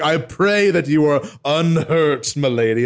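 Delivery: overly Shakespearean. Acted. Game character greeting his lady, overly Shakespearean. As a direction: drag it out like a Shakespearean gallant. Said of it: "overly Shakespearean and no one else does".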